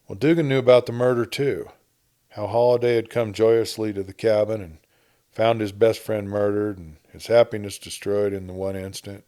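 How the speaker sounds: usual speaking voice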